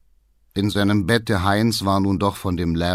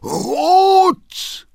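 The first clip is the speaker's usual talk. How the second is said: very high, loud shriek of outrage; long, piercing, appalled yell; screamed; 6 dB louder than usual. A narrator's reading. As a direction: scream the word in disbelief. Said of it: "schrie der Vater".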